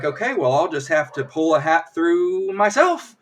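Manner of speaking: mock magician's proclamation, climbing at the end; showman's announcement. Magician announces a new trick instead, showy rising voice.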